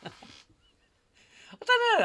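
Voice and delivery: real laugh stretched through the words. Real, not acted. Laughs at his own tangent.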